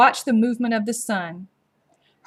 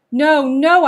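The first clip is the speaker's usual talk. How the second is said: higher, louder pleading cry; desperate begging; 6 semitones higher, 9 dB louder than usual. A narrator's reading. Boy begging soldiers as they drag them off, loud pleading. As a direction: beg them not to drag him. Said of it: "I begged".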